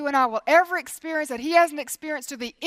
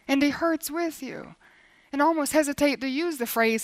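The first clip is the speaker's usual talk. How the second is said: normal talking voice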